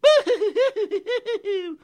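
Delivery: high, loud boo-hoo crying noise; sad high sobbing. Acted. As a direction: wail like a tiny sad pixie. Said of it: "very sad".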